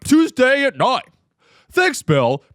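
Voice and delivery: high, loud, over-bright anchor read; news reporter accent. Retold or imitated. Mocking TV news anchors, hammed-up anchor voice. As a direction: ham up a TV news anchor teaser. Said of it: "talks in the news reporter accent".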